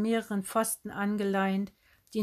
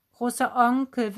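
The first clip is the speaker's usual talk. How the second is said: higher, plaintive repeated plea; wimmernd; 4 semitones higher, 6 dB louder than usual. A narrator's reading. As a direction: whimper it, lightly acted. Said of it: "wimmerte Tante Heidrun noch einmal". (wimmernd, klagend)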